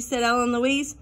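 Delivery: usual speaking voice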